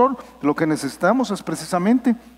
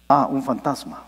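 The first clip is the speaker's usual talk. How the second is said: flat calm exclamation; 7 semitones lower than usual. Shown as a demonstration. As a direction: say "Ah, un fantasma" low and deadpan, no alarm at all. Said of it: "yo no diría así".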